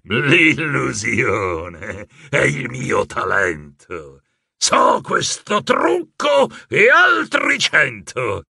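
slow, scornful, rising to a shout; sneering villain. A narrator's reading. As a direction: sneer it out like a proud trickster. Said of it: "Lui sogghignò sprezzante". (il mago sogghigna sprezzante con Rebella)

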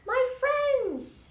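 high, loud joyful cry. A narrator's reading. Horton finding his friends at last, crying out. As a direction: cry out to long-lost friends. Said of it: "he cried".

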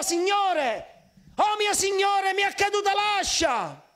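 high, desperate cry to the Lord; dramatic high-pitched outcry. Acted. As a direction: call out in alarm over what was lost. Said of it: "Lui gridò".

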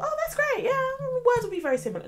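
very high, loud imitation of naive optimism; mock-naive delight. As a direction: squeal it like your overconfident past self. Acts out her naive earlier thought.

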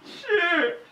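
high, shrill-edged jolt of fright; startled cry. Real, not acted. Gamer hit by a sudden scare, startled high cry. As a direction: caught off guard by a jump scare. Jump scare in horror game.

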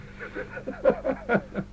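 breathy high laugh of real amusement; delighted laugh. Real, not acted. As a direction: laugh warmly at your own story. Amused by his own anecdote.

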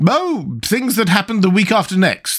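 high, airy offhand reply; careless tone. A narrator's reading. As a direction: toss it off in a high voice. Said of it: "replied in a careless tone".

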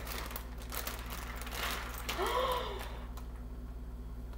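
long soft gasp of wonder; surprised gasp. Real, not acted. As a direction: gasp in delight. Seeing the ice cream ready.

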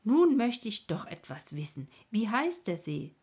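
loud opening, easing into a question; calling out. A narrator's reading. Stineli grabbing Rico to ask the lake's name, calling out. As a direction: burst out with a curious demand. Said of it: "fasste es ihn am Arm und rief".

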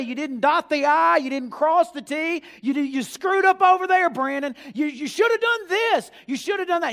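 loud, high nitpicking scolding; scolding accuser. Retold or imitated. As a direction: bark petty faults like a harsh judge. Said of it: "not to say".

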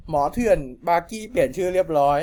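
plain reading voice

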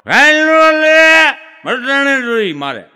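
put-on slow, loud drunken yell at the manager. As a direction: bellow it like a drunk refusing to wait. Drunk yells at bank manager. (નશામાં ધીમી, મોટેથી બૂમ)

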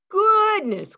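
high, loud astonished exclamation; amazed goodness. A narrator's reading. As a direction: parents marvel at the news. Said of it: "Clyde's parents said together".

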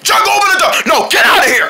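high, forceful holler; shouted demand. Acted. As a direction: shout it urgently through a closed door. Shouting match through the door.

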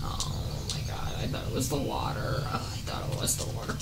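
whispered wheezing laugh through the words; breathless laughing. Real, not acted. Gamer laughing breathlessly at mistaking the water. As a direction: laugh silently at your own mistake.

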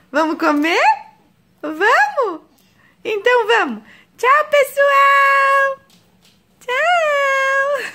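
very high, drawn-out cooing; mother sings goodbye to viewers in baby talk. Acted. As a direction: stretch the goodbye high like to an infant.

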